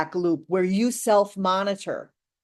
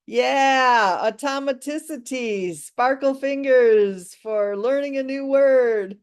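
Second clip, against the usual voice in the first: drawn-out, raised cheer with stretched words; celebratory cheer; 3 semitones higher, 3 dB louder, slower than usual. Real, not acted. Teacher rewarding learners for learning a new word, celebratory cheer. Celebrating the finished repetition drill.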